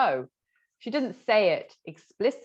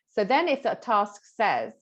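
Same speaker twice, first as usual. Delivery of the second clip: normal talking voice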